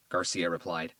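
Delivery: plain reading voice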